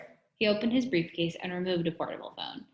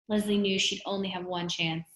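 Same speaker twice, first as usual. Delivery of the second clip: ordinary delivery, nothing marked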